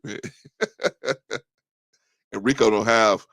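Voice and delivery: ordinary talk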